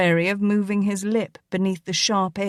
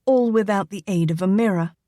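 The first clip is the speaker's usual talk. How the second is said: ordinary delivery, nothing marked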